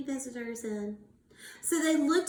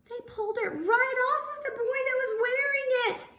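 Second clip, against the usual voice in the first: shocked gasp; 13 semitones higher, faster than usual. Real, not acted. Teacher to class, a shocked gasp. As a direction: gasp then high, quick telling. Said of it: "gasp at picture".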